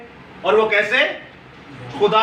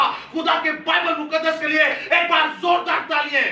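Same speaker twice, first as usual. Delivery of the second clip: higher, driving, chanted litany of praise; preaching at full force; 5 semitones higher than usual. Real, not acted. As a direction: proclaim each phrase like a rallying cry.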